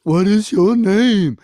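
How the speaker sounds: loud, slow giant's bellow; Cyclops demanding. Acted. Cyclops presses Odysseus for his name, loud slow bellow. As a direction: bellow the question slowly, demanding an answer. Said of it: "And Polyphemus says".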